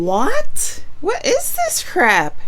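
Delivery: high, loud, incredulous outburst; shocked disbelief. Real, not acted. Listener hearing the gruesome story, shocked disbelief. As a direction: blurt it out in real shock, pitch leaping.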